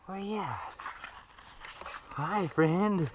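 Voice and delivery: high coaxing call to a cat; sweet high pet call. Real, not acted. Calling cat in tree.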